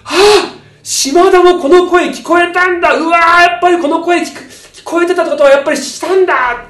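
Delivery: loud, high amazed exclaiming; can't believe it; shocked realization. Retold or imitated. Crew realizing Shimada heard the voice too, loud shock.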